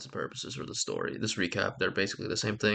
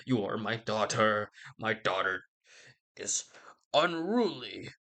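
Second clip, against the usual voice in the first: mock angry father; 2 semitones higher than usual. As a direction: scold the unruly daughter in a staged fury. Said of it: "He's very angry".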